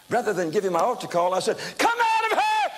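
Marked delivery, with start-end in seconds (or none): 1.8-2.7 much higher, loud command at the demon; rebuking scream. Retold or imitated. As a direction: scream it out, high and forceful. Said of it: "I scared the people I screamed so loud".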